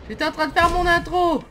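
high, loud and fed up; loud exasperated complaint. Real, not acted. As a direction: protest loudly at being interrupted. Streamer complains at game character.

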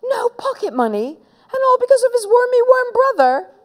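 high-pitched, outraged questioning; indignant disbelief. Acted. Henry indignant at his punishment.

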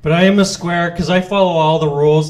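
ordinary talk

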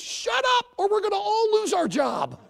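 high, loud urgent hiss-free warning; lion telling the fake gorilla to shut up; hushing lion.

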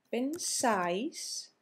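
pensáis, drawn out slowly with the stress on "sáis"; slow "pensáis", stressed on the final syllable. Shown as a demonstration. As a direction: lean long on the A in "pensáis". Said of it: "con acento en la A".

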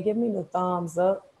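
ordinary delivery

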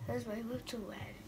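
quiet. Real, not acted. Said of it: "I cannot talk loud".

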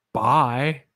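long held bye. Retold or imitated. Friend's way of saying bye, held long. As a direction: drag out the bye. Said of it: "he holds it. Like, he doesn't say bye".

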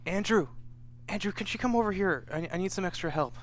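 lightly voiced nurse calling a colleague over; Sophia calling for help. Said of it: "and she's like".

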